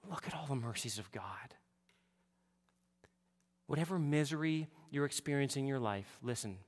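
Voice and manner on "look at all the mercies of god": hushed whispered appeal; soft reverent whisper. Real, not acted. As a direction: whisper it to the congregation.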